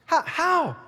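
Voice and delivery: high-pitched, disbelieving question; baffled questioner. Acted. Questioner hearing of money for police families, acting baffled.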